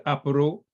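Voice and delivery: "Apuru" said as apple with added Japanese vowels; "Apuru", three syllables ending in a u; Japanese pronunciation. Retold or imitated. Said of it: "some of the children said".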